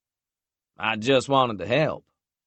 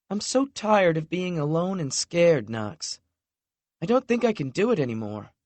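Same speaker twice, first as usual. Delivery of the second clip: higher, quicker voice for an exhausted woman; a hint of a worn-out girlfriend; lightly voiced weary young woman; 9 semitones higher than usual. A narrator's reading. Lexi confessing to Knox she is tired and scared, lightly voiced.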